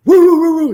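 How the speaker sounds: high loud howling dog barks; loud, high imitated police dog baying; dog barking imitation. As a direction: howl and bark like a hunting dog. Imitating dogs closing in.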